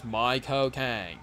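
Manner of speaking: "My cocaine" slow, in a Cockney British accent; Michael Caine British accent. Shown as a demonstration. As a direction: drawl "My cocaine" like a British film star. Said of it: "say it with a British accent".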